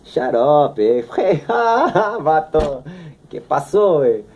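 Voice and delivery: lightly put-on Mexican accent with a laugh; Mexican accent impression. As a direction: toss out a Mexican-accented 'vato, qué pasó', half-mocking. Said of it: "Or Mexican".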